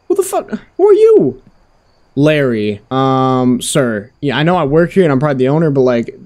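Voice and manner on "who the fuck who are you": high-pitched startled demand; alarmed, pitch leaping up. Pretend. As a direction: blurt it out high, caught off guard. Startled by an unexpected visitor.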